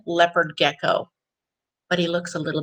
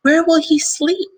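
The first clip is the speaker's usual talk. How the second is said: higher, curious mother's question; a lightly voiced mother, raised pitch; mother asking; 10 semitones higher, 6 dB louder than usual. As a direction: ask like a puzzled mom. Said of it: "his mother asked".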